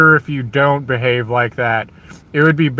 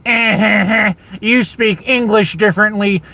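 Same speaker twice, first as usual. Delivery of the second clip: higher-pitched jeering cackle, taunting; higher mock laugh sneering at an accent; mocking laughter; 7 semitones higher than usual. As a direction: laugh at them like a schoolyard bully, higher up. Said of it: "you did something other than".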